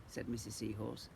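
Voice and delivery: ordinary delivery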